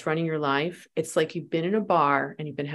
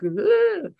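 frustrated ugh; 14 semitones higher, 3 dB louder than usual. Retold or imitated. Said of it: "he could tell that I was all like".

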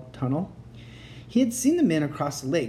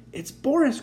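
high, loud excited mother voice; mother crying out; 12 semitones higher, 4 dB louder than usual. Acted. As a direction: cry out with joy. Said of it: "cried his mother".

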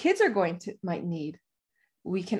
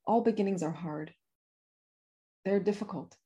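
softer, choked with real feeling; quietly emotional; 3 dB quieter than usual. Real, not acted. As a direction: let the emotion seep in quietly. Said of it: "Oh, I feel emotional thinking about it".

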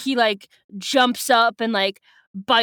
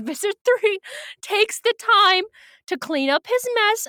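mocking; 10 semitones higher than usual. Real, not acted. Mocking Visser Three's tidiness.

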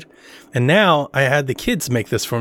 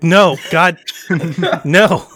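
louder, laughing in exasperation; loud half-laughing despair; exasperated no; 3 semitones higher, 4 dB louder, much slower than usual. Retold or imitated. Father despairing at his kids' answer, laughing and loud.

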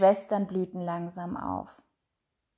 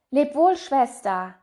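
higher, louder bright goodbye; sister's farewell; 6 semitones higher, 5 dB louder than usual. A narrator's reading. Blooming sister saying goodbye to Röslein, bright. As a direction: call a cheerful farewell to the little sister. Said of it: "sagte die eine".

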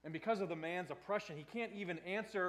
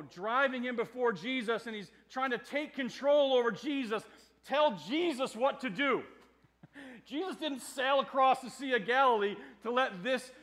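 louder, driving sermon delivery; forceful preaching; 7 semitones higher, 10 dB louder than usual. Acted. Preacher insisting Jesus takes control, loud and forceful.

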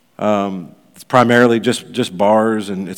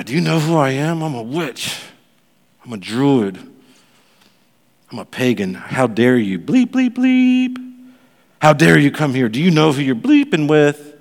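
mock angry witch; 4 semitones higher than usual. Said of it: "she flipped out".

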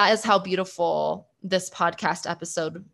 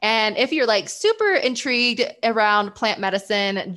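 ordinary talk

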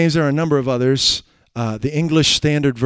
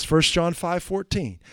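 normal talking voice

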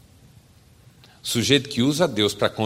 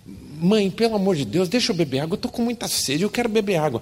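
put-on higher, desperate little boy; pleading child; 8 semitones higher than usual. Son begging mother for water, higher pleading. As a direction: beg in a child's high voice.

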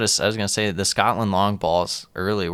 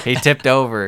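louder, higher teasing jeer; rowdy jeering pub banter; 5 semitones higher, 9 dB louder than usual. Real, not acted. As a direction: crow it out, mocking.

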